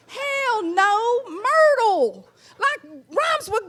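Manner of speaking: mock indignant Southern correction. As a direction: set her straight with attitude.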